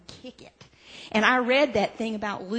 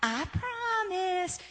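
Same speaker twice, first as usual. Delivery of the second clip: sweet-talking Lucy; 8 semitones higher than usual. Retold or imitated. Lucy coaxing Charlie Brown to kick, sugary higher voice. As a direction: lure him in sweetly. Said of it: "Lucy would say".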